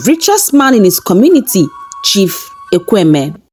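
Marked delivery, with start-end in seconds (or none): none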